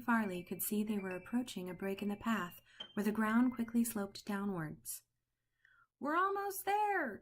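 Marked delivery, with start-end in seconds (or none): 6.0-7.2 yelled. Acted. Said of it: "Turtle yelled back".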